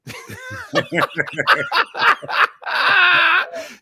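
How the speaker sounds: high laughing shout; laughing shriek. Real, not acted. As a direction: burst out in a howling laugh. Reacting to photo, laughing shout.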